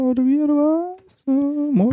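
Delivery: squeaky high-pitched catchphrase; falsetto. Pretend. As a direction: toss it off in a silly falsetto. Silly high voice repeating catchphrase.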